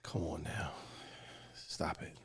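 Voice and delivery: very soft, near-whispered objection; hushed protest. Real, not acted. Co-host asks about the playoff hunt, hushed protest. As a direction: beg him under your breath to drop it. Dismisses the playoff question, barely audible.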